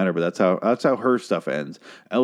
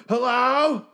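"Hello?" very high and drawn out, mocking; mock horror victim. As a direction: ham up the scared victim calling into the dark. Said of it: "Just don't go".

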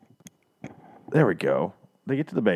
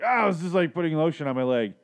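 higher, mock-innocent cover story; caught-out excuse; 6 semitones higher, 4 dB louder than usual. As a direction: blurt a flustered alibi. Caught out, giving hasty excuse.

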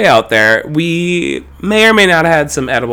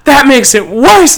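mock panicked; 10 semitones higher, 6 dB louder, slower than usual. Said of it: "She goes".

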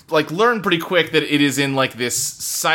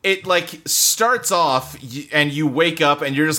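normal talking voice

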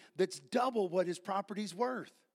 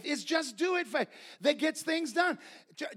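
ordinary delivery

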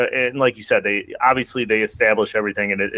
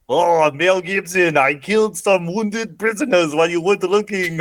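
mock French villain; 5 semitones higher than usual. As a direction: taunt like a cartoon French baddie. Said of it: "if the villains were French".

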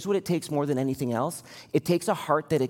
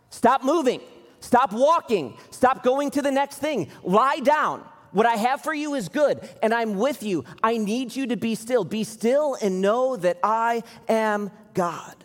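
voice of God; 7 semitones higher than usual. Acted. God commanding David to rest.

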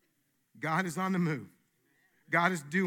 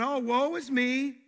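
slow, whiny woe-is-me voice, higher; drawn-out mock lament, pitched up; mock self-pity; 6 semitones higher, 5 dB louder, slower than usual. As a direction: ham up the poor-me victim. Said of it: "This isn't about".

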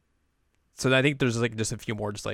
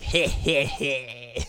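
smug snicker; 3 semitones higher, much slower than usual. Acted. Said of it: "Kasumi's just like".

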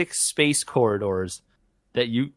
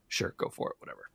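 dismissive mutter; 12 dB quieter, faster than usual. Real, not acted. Dismissive aside under breath.

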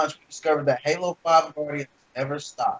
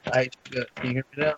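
breaking up. Real, not acted. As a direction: voice breaking up over the line. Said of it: "still breaking up".

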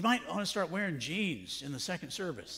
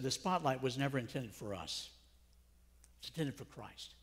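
soft, lower, gentle answer; graceful; 5 semitones lower, 6 dB quieter than usual. Retold or imitated. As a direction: say it humbly and gently. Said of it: "so gracefully said".

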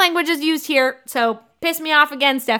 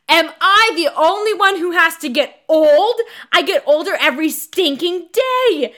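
higher, louder whining outrage; mock tantrum; 5 semitones higher, 4 dB louder than usual. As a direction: wail it like a melodramatic teen. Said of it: "And she's just like".

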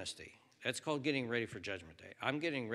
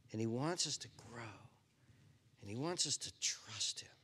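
ordinary talk

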